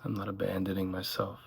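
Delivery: calm meditation voice, gradually softening; unhurried phrases with long silences between them; guided meditation. Acted. Guide leading listeners through one breath at a time, guided meditation.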